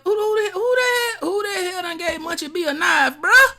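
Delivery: high, loud squawk of disbelief; incredulous outburst. Real, not acted. Streamer reacting to Munchie with a knife, incredulous. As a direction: shoot up high and loud, tripping over the first words.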